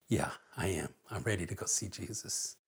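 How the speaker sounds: very low, barely audible murmur; hushed, fragile retold last words; faint dying words. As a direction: softly voice a dying mother's peaceful answer.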